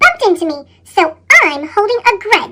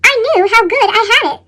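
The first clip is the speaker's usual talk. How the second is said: usual speaking voice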